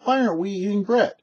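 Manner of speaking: a hint of a child's puzzled question; curious child asking. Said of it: "so the kids should say".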